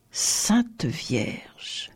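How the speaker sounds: hushed, voiceless gasp of dismay; aghast. A narrator's reading. Tourba spots the royal crown, aghast and hushed. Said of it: "balbutia-t-il, effaré".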